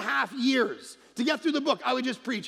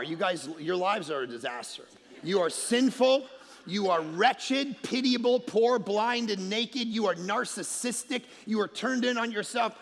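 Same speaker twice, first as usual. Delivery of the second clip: preaching at the crowd; 4 semitones lower than usual. Acted. Pastor demonstrating how he'd rant at sinners. Mock ranting sermon.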